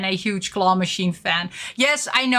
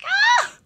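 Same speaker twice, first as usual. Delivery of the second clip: very high squeak of delight; sudden squeal of joy. Real, not acted. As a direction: let out a tiny high squeal. Squeals at the figure.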